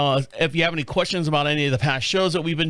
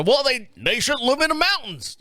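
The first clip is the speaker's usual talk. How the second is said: high-pitched mock indignation; put-on higher voice of a dismissive outsider; mocking critic; 7 semitones higher than usual. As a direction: scoff like an imagined know-it-all, voice pitched up. Mocking critics of stranded mountain residents.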